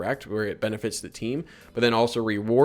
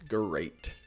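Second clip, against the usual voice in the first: a low, falling cartoon-tiger "great,"; mock Tony the Tiger "great,"; 4 semitones lower, 7 dB quieter than usual. Shown as a demonstration. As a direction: drag out "great," like a cereal-box tiger. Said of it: "who am I, Tony the Tiger?".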